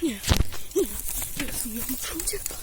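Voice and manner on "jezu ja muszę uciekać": quick panicked whisper; fast whisper. Real, not acted. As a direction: hurry it out under your breath. Fleeing the neighbour.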